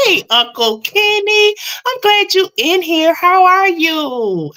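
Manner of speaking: high, loud, delighted hello with a slow "how are you"; light up for a favourite uncle; happy greeting. Real, not acted. Greeting uncle joining the stream.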